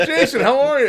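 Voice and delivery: high, loud greeting through laughter; laughing greeting. Retold or imitated. Customers walking in greeting Jason, laughing. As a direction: call out cheerfully while laughing.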